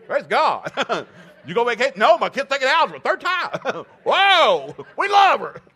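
high, loud laughing praise; hammed-up jubilant shouting; mock praise shout. Preacher mocking praise over a kid retaking algebra, loud and high.

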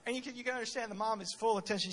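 ordinary talk

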